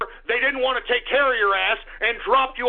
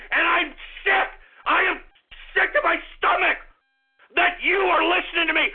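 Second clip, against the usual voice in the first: furious tirade. Real, not acted. Host sick of his chat berating it, furious shouting. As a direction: hurl each insult at full force.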